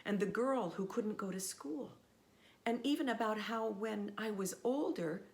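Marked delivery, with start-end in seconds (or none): none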